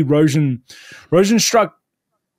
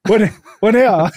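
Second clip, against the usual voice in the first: mock panic, higher and louder; hammed-up helpless bewilderment; deer in headlights; 6 semitones higher, 6 dB louder than usual. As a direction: imitate a fighter frozen and lost, pitched up. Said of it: "like a deer in the headlights".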